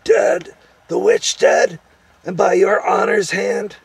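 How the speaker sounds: high, loud, squeaky disbelief; astonished gnome. A narrator's reading. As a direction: cry out amazed as a little gnome. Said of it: "opening its ridiculous mouth wider in astonishment".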